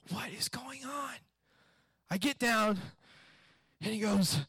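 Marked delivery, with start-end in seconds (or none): 0.1-1.2 hushed, bewildered aside; baffled whisper. Retold or imitated. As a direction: whisper it in stunned confusion. Baffled adult amid the screaming.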